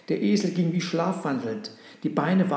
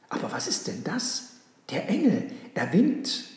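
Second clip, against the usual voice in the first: hushed question rising to high wonder; dawning astonishment, pitch leaping up; amazed discovery; 4 semitones higher than usual. A narrator's reading. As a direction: start hushed, then lift in amazement. Donkey suddenly sees the angel.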